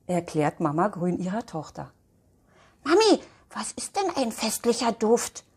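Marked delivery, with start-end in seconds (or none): 2.8-5.4 put-on high, breathy child whisper; curious little girl. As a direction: ask it wide-eyed like a small girl. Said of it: "will Elfi jetzt genau wissen". (Elfi fragt die Mama neugierig, hohe geflüsterte Kinderstimme)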